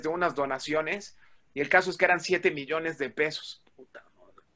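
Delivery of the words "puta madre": barely voiced, breathy curse under the breath; whispered curse. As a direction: drop to a whisper as if dismayed. Muttered curse at the mistake. (maldición susurrada, casi sin voz)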